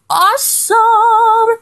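long drawn-out, high "awesome"; "awesome" held long and lifted high in admiration; gushing emphasis. Real, not acted. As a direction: stretch AWESOME out high, almost sung. Gushing about a favorite concert.

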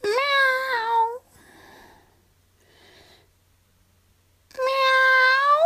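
high, thin kitten meow; cat meow. Acted. Cat girl meowing.

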